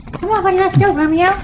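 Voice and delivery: high, bright call to the dog; happy call. Real, not acted. Trainer calls the dog out the door, high. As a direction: call the dog along cheerfully.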